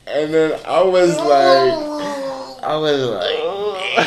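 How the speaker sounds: slow, cracking laugh through the words; laugh-crying at the memory; words stretched out by laughter. Real, not acted. Laughing through the rejection story.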